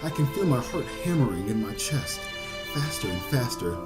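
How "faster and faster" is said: ordinary talk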